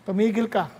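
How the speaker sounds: lightly voiced Lord, calm and firm; God voice. Lord telling the eager believer to stop, calm and low. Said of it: "sabi ng Lord".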